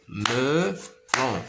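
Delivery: "Melon" in two separated beats; drawn-out, split "Melon" for learners; syllable by syllable. Shown as a demonstration. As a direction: sound out "Melon" as me, pause, lon. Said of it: "se compose de deux syllabes".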